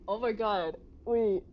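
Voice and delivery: loud sudden oh my god; shocked outburst. Real, not acted. Streamer reacting loudly to the game twist, shocked shout. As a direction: blurt it out in shock.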